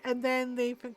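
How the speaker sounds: ordinary talk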